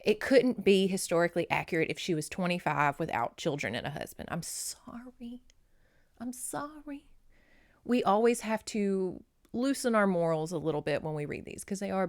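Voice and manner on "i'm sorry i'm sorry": soft, unvoiced sorry, said slowly; whispered apology. Real, not acted. Apologizing to viewers about the age gap, in a whisper. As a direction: whisper the apology as a sheepish aside. Apologizing after a taboo remark.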